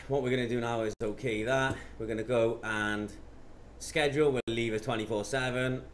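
ordinary talk

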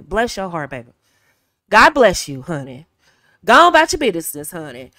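Southern sweet dismissal. Shown as a demonstration. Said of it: "we love that saying".

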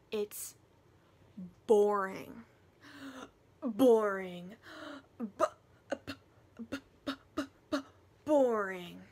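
loud, punched "boring" with stuttered b's; emphatic mock verdict. Reader condemning the bare plot, loud emphatic mockery. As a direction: boom it out, stutter the b, then boom it.

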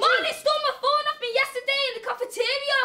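high, agitated outcry; indignant, pitch leaping up; heated accusation. Acted. Accusing Marley amid the scuffle.

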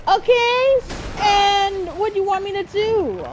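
high, loud protest; exasperated. Real, not acted. Player protesting to the game, high exasperated outburst. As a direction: raise the pitch in frustrated protest.

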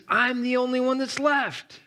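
mock Elijah. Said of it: "he says".

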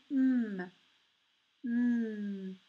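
"M" as a long closed-lip hum; sustained mmm for "M"; isolated M sound. Shown as a demonstration. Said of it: "Comme ça".

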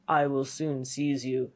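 low, slow threat; menacing fiend. Acted.

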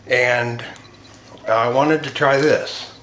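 talking around pipe stem; pipe in mouth. Real, not acted. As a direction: lips clenched on pipe. Seen 1: Pipe stem clenched in mouth throughout the labelled words; absent in references.

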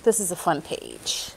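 usual speaking voice

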